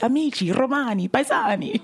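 Italian line. Retold or imitated. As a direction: savour the Italian words, half laughing. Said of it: "an accent can change everything".

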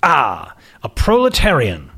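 put-on upper-class prep school and British accent; lofty aristocrat sizing up a young woman; aristocratic accent. Magazine boss greeting a job candidate, aristocratic accent. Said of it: "His accent was patently aristocratic".

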